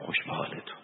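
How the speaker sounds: barely audible whisper to himself. Retold or imitated. The sheikh passing the sick at the shrine, whispering. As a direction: breathe the words out under your breath. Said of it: "با اینکه آهسته گفت".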